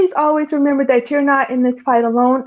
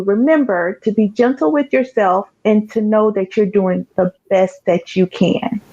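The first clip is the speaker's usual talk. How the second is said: normal talking voice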